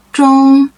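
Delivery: 中 held high and level; first tone. Shown as a demonstration. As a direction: sustain 中 on one high pitch. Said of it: "is the first tone".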